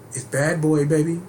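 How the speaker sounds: normal talking voice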